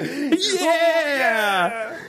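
high, loud, laughing squeal of delight; excited kid yell. Retold or imitated. Cohost showing glee at the accent bit, squealing. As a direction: cheer like a kid on Christmas morning. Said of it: "like a kid on Christmas".

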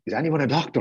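mild, high mimicked scream; retold scream. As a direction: imitate a frantic cry for help. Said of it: "runs out of the internal room screaming".

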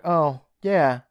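hoger en luider, zelfingenomen; smug flattered. Retold or imitated. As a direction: gevleid en trots, hoger en luider. Andrew flattered, ego stroked.

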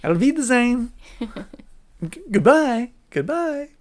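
high, loud sing-song farewell; hammed-up theatrical goodbye quote; Men in Tights goodbye. Host signing off listeners with a hammed-up movie farewell.

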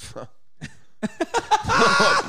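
breathy high-pitched laughing fit; high burst of laughter. Real, not acted. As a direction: laugh out loud at the absurdity. Laughing at absurd customer story.

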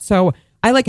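ordinary talk

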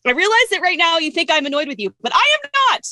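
mock exasperation climbing sharply high; comically over-insistent denial of annoyance. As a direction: protest in a strained, high voice, peaking at the end. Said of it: "but I say over and over".

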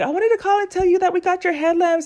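bright, high phone-greeting voice; cheerful supervisor on the phone. Retold or imitated. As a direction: sound like a friendly supervisor calling with good news. Voicing the supervisor's phone call.

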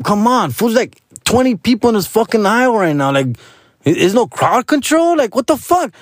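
high-pitched retold griping; exasperated complaint. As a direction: act out impatience with the crowd. Acting out exasperation at crowded aisle.

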